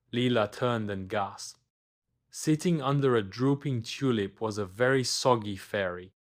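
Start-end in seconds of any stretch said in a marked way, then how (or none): none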